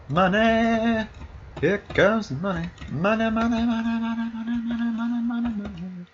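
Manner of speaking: high-pitched greedy money chant; cackling mad scientist glee. Pretend. Gleeful over shop money.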